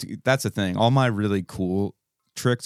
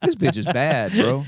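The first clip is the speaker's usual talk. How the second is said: higher, louder excited gush; smitten; 5 semitones higher, 3 dB louder than usual. Retold or imitated. Hearing a girl's Cockney accent, excited gush. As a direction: swoon over her accent. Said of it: "I would just be like".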